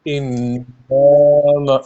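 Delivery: انما with long ghunna on نّ; nasal hold on inna. Shown as a demonstration. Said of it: "غنہ ہے ایک". (انما میں نون پر لمبا غنہ)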